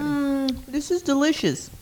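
high, pleased tasting mmm; savoring delicious food. Real, not acted. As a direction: relish the bite. Tasting a new mushroom.

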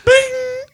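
high-pitched imitated phone ding; mock Australian ringtone. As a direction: sing out a very high ringtone "bing". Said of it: "That's an Australian ringtone".

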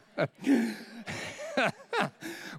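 a high burst of laughter. Real, not acted. Preacher laughing at himself, real high laughter.

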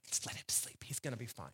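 very soft, half-whispered and trailing low; hushed coaxing. Acted. Disciples urging Jesus to let Lazarus sleep, hushed. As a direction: drop to a hush as if not to wake him.

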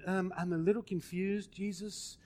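soft, timid, falsely puzzled question; mock confused. Shown as a demonstration. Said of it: "That's not what he's saying".

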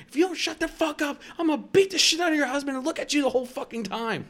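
high, loud acted tirade; retold raging threat. Threatening a lady who talks shit, retold raging threat. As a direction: yell the threat, re-enacting a meltdown.

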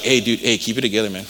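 imitating the best man, low and hushed; hushed urging. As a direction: urge a friend to hold it together under his breath. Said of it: "my best man's behind me like".

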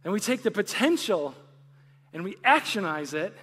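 fervent preacher in full flow; preaching. Acted. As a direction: build it up like a pulpit appeal. Preaching builds to full force.

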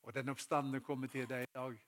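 ordinary delivery

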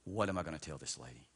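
low, soft plea under the breath; hushed retold prayer for help; inward prayer. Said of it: "on the inside, I'm praying".

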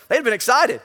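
ordinary talk

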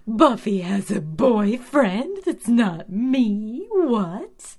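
high, loud, slow disbelief; outraged at the screen; shocked outrage. Real, not acted. Fan reacting to the episode with shocked outrage.

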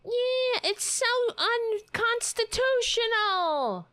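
slow, very high screechy impersonation; mocking yell. Podcaster guesses what the politician is yelling, high mocking yell. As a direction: stretch it out as a high mock yell. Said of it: "Is that what you're yelling?".

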